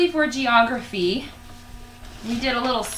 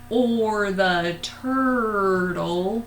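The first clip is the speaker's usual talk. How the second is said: very slow turtle voice; 4 semitones lower, much slower than usual. Shown as a demonstration. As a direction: drag the words slowly like a turtle. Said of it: "funny voices that I have include".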